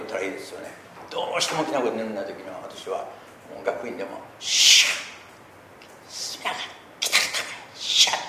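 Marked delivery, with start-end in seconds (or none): 4.4-8.2 sharp voiceless bursts of breath; voiceless prayer shout. Shown as a demonstration. Pastor demonstrating silent-voice prayer, sharp voiceless bursts. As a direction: spit out whispered bursts like a martial cry. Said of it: "こういうようなね、気合いみたいな音を無声音で".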